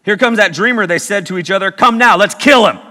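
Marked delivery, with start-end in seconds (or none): 1.7-2.8 louder, higher scheming call; rally the brothers to kill; loud menace. Acted. Brothers plotting, read loud.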